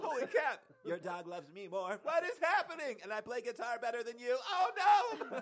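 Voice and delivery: high, loud panicked yelling; panicked shrieking. Pretend. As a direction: shriek in mock horror. Panicked owner losing house.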